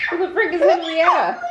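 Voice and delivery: high laughing exclamation; laughing shout. Real, not acted. Laughing outburst at wrong name.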